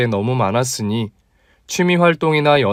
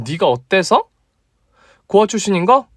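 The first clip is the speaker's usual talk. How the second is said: put-on higher, teasing young woman; female friend voice; 7 semitones higher than usual. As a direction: play the bold female doctor, pitched up. (높은 톤의 당찬 여자 목소리)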